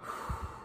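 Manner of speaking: slow audible exhale; deep calming breath. Shown as a demonstration. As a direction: breathe out slowly to calm down. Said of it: "You model the calming technique".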